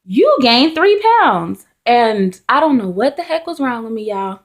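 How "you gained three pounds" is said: high, bright-announcing nurse, slowing down on the news; cheerful nurse. Retold or imitated. Nurse at the six-month checkup announcing the weight gain, high and slow. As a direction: deliver the news like a beaming nurse, drawn out. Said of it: "she goes".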